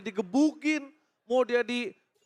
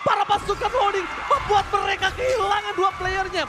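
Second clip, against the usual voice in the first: esports caster; 7 semitones higher than usual. Acted. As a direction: call the fight live, excited. Caster calls the fight again.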